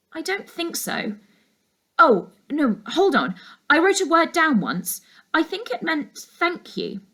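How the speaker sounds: put-on a girl suddenly remembering; young girl.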